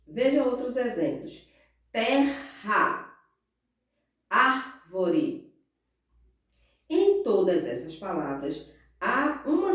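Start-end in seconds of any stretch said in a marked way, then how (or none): 1.9-3.1 "Terra" split slowly as ter-ra; syllable by syllable. Shown as a demonstration. Teacher gives another example, syllable by syllable. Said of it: "pronunciando cada uma das suas sílabas". 4.3-5.5 "árvore" split as ár-vore, first syllable strong. Shown as a demonstration. Teacher gives another example, syllable by syllable. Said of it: "pronunciando cada uma das suas sílabas".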